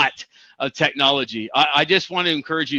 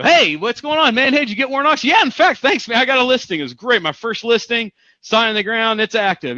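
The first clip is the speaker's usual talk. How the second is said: much higher and louder, thrilled to report the listing; bursting with good news; excited; 7 semitones higher, 3 dB louder than usual. Retold or imitated. Telling the young agent about his first listing, excited.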